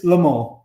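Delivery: Le Mans said "luh MOH", silent S; nasal French ending on Le Mans, no N or S; French pronunciation. Shown as a demonstration. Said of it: "if you're speaking with a French accent".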